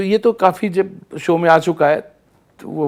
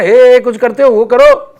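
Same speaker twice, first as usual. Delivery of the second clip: loud, higher shouted demand; mock yell; 6 semitones higher, 10 dB louder, slower than usual. Director demanding the shouted part, loud and higher. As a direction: bark it like an impatient director. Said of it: "वो जो चिल्ला के आते हो ना".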